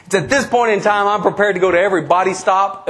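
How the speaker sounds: ordinary talk, nothing marked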